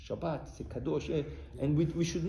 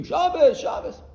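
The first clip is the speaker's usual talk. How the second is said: high, loud imitated street yell; mimicked scream; 11 semitones higher, 10 dB louder, slower than usual. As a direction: yell it out as protesters do. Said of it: "they, they scream".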